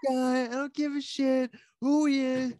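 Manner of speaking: hammed-up furious fan voice; mocking angry fans. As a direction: jeer at the fighter in a high sneer. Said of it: "And they're like".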